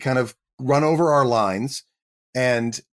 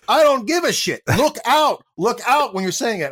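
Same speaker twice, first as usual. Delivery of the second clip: much higher, louder, brusque commands; excitable director yelling; 10 semitones higher, 3 dB louder than usual. Retold or imitated. Director Michael Bay ordering the actor to look up, high and loud. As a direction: bark it like an impatient director.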